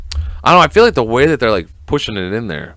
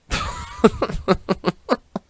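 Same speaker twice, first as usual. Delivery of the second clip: light high airy chuckle; soft, breathy laugh at own remark; quiet high giggle; 10 semitones higher, 6 dB quieter than usual. Real, not acted. Chuckles at rooting for Dahmer.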